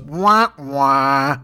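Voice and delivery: mocking sad-trombone wah-wah; callous mock trombone; Corey Lewandowski. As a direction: jeer it like a dismissive sound effect. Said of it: "Corey Lewandowski goes".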